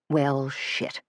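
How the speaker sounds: quiet muttered swear. Acted. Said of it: "Suzanne muttered".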